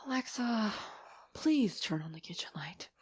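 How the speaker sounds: soft, hushed request; polite pleading. Retold or imitated. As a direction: ask the robot meekly, almost whispering.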